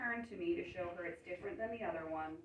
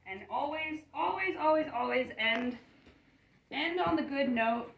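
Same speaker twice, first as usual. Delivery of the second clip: higher and louder, each always pressed; emphatic rule; 6 semitones higher, 7 dB louder than usual. Shown as a demonstration. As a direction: drive home the golden rule. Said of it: "repeats the key rule".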